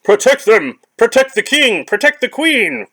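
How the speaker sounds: high, loud battle cry; rally the guards, shouting high; protect-the-king shout; guard's shout. Acted.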